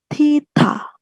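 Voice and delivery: "Tita" with a strong puff after the t; aspirated tita. Shown as a demonstration. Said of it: "T H ini". ("Tita" beraspirasi kuat)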